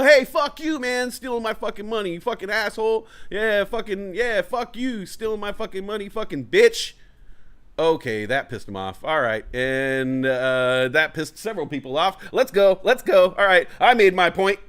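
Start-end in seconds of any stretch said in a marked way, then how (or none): 0.0-6.9 high yelled taunts at a thief; shouting insults while following a mugger; mocking insults. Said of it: "I'm gonna make fun of you". 12.3-14.6 hasty retreat. Retold or imitated. Backing off after angering them.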